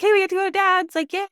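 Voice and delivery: high, loud singsong mimicking gleeful children; excited kids. Kids excited to leave for dad's.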